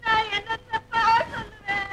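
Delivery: drawn-out tearful wail; crying. Acted. Daughter breaking bad news to father, crying. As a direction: sob it out slowly to the end. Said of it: "அம்மா அழாதம்மா".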